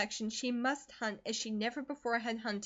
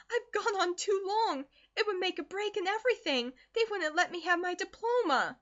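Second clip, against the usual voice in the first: much higher, pleading and desperate; beg, voice pitched high in despair; 9 semitones higher, 4 dB louder than usual. Acted. Daughter pleads about her diploma, high and desperate. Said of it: "said Eleanor desperately".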